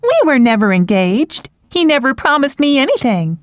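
distressed protest. Acted. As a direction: insist through heartbreak.

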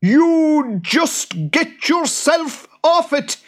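very high, slow, loud yell; boggart yell. Acted. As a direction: bellow it like a tiny tyrant. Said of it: "yelled the boggart".

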